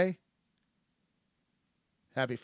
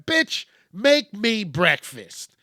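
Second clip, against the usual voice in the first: louder, higher and slow, a fed-up demand; demanding yell; 6 semitones higher, 6 dB louder, slower than usual. Retold or imitated. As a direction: bark it like a hungry customer who's had enough. Angry customer demanding breakfast.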